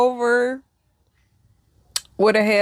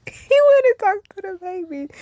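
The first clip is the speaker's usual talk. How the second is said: pitched way up, amused and incredulous; high laughing disbelief; 9 semitones higher than usual. Shown as a demonstration. Retelling the ghost visiting the baby, high and amused. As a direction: squeak it out in disbelief.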